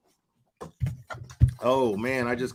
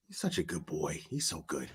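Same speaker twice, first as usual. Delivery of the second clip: softer, lower tender praise; 7 semitones lower, 11 dB quieter than usual. Real, not acted. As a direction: murmur proud praise of the dog.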